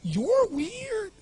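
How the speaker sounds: put-on very high, drawn-out weird drunk voice; bizarre beggar voice. Drunk beggar judging the young man, bizarre voice. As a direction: stretch it out in a high put-on squeak. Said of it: "this really bizarre looking sounding voice".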